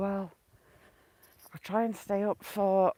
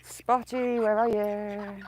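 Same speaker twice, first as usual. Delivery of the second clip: coaxing call; much slower than usual. Real, not acted. Calling favourite duck.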